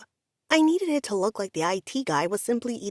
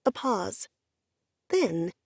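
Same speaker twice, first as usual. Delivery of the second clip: usual speaking voice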